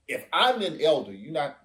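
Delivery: ordinary talk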